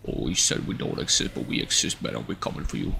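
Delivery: low hushed threatening whisper; menacing whisper. Acted. North Pole people whispering a low threat at the streamer. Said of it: "group of people that doesn't even exist".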